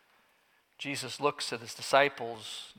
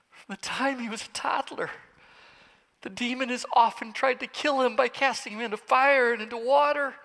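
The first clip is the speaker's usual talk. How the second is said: rising from tearful to a high, loud desperate plea; a father crying out for his child; tearful father breaking; 9 semitones higher, 5 dB louder than usual. Acted. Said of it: "With tears".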